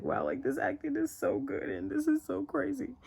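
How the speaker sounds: high, teary voice, mid-sob; retelling her own tears at the screen; tearful bawling. Retold or imitated. Recalling watching the scene, high and teary.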